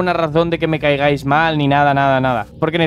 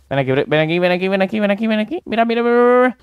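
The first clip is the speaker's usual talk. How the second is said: eager calling; 5 semitones higher, faster than usual. Real, not acted.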